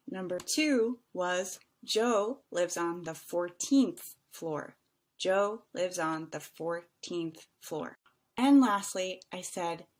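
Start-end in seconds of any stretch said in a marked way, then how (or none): 1.8-8.0 slow, spaced words, "fourteenth" drawn out; slow answer model. Shown as a demonstration. Teacher checking answers, slowly sounding out "fourteenth". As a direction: separate and stretch "fourteenth".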